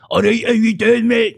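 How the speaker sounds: high, loud cartoon character greeting; cartoonish voice. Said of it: "he's got quite a cartoonish, uh, voice".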